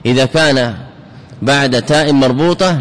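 ordinary talk, nothing marked

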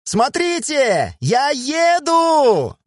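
high, slow, stretched-out cries of joy; delighted shouting. A narrator's reading. Miyu riding the bike calls to the men behind, slow high delighted shout. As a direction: draw it out high and loud, riding for the first time. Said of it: "закричала Мюи".

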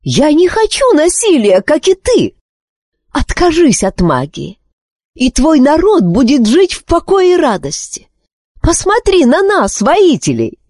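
high, urgent appeal; desperate plea. A narrator's reading. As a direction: beg to be understood. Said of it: "в отчаянии воскликнула королева".

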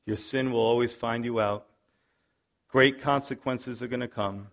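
ordinary delivery

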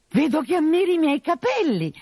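high, coy falsetto of a man posing as a lady; disguised bride voice. Acted. Arlecchino in disguise, flirting with the captain, high and coy. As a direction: play the fake bride, coy and sweet.

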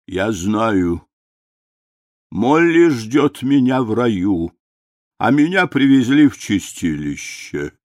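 медленный, жалующийся старческий голос; пожалуйся дряхлым голосом; жалобный старик. A narrator's reading. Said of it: "пожаловался больной". (жалобный старческий голос)

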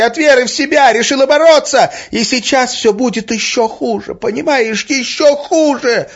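hysterical woman, very high and loud; shrieking. A narrator's reading. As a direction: keep shrieking in frantic self-blame. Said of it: "взвизгнула Арина". (истеричный визг)

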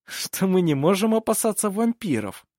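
high, lively jest; amused laughing reply. A narrator's reading. Nephew joking to his uncle about vampires, amused. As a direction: toss it out as a cheerful joke. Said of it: "засмеялся Ив".

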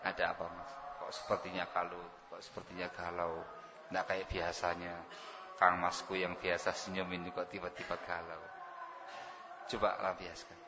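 a wife coaxing her husband to vent, soft and tender; gentle and soft. Shown as a demonstration. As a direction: coax him tenderly to open up. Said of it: "Halus ya?".

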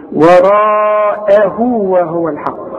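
وراءه وهو الحق drawn out slowly, with the vowels held long; slow recitation demo. Shown as a demonstration. Teacher chants وراءه وهو الحق slowly for his student. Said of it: "أعلمك إزاي تنطق وراءه إزاي".

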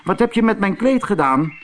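high, fast angry demand; angry shout. Acted. Antonio roept boos tegen het standbeeld: hoog en snel. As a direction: demand it back from the statue. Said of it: "riep Antonio".

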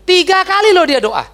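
high, loud stress on Tiga kali; loud, amazed preacher; emphatic. Real, not acted. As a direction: ring out three times with amazement. Preacher stresses three prayers.

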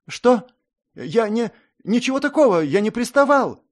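high, loud frantic denial; panicked babbling. A narrator's reading. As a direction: stammer out a scared denial. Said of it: "бессвязно забормотал юнец".

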